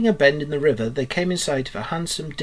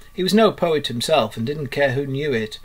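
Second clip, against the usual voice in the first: plain reading voice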